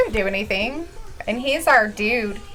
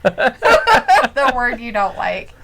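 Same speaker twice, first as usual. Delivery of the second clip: slow, giggly tease; amused teasing; 4 dB louder, much slower than usual. Real, not acted. Teasing partner about the disliked C word, amused. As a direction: say it slowly, laugh just behind it.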